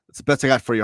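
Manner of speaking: normal talking voice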